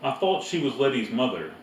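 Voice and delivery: ordinary delivery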